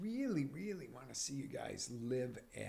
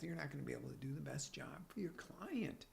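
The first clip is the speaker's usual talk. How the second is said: ordinary talk, nothing marked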